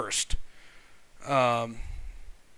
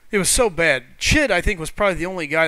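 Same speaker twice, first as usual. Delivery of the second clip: louder, leaning on "so"; emphatic louder; 2 semitones higher, 7 dB louder than usual. Real, not acted. Streamer recalling his brutal lag, louder and emphatic. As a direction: really hit "so bad" harder and louder.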